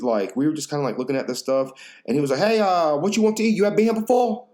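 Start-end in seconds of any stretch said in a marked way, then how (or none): 2.4-4.5 higher, louder mock owner greeting; imitating the owner. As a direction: play the pushy restaurant owner, pitched up. Restaurant owner greeting them.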